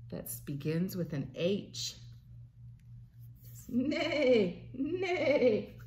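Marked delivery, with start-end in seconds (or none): 3.7-5.8 high, loud neighs; whinny it out like a horse; neighing horse. Pretend. A parent neighing like a horse for a toddler. Horse noise in guessing game.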